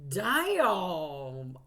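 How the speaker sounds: a loud, startled "Damn"; loud exclamation. Real, not acted. Reader blurts at the spirit's message, loud exclamation. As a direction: blurt it out, hit hard.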